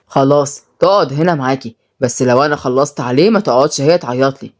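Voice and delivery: low, resolute male figure; stern son. Acted. Son telling mother he won't intervene, stern.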